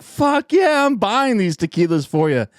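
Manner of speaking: high, loud whoop; excited cheer. Acted. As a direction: cheer him on wildly. Bartender cheering in joke.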